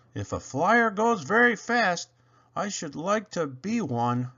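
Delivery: a small engine's eager answer; little engine answers his teacher. Acted. Toodle answers.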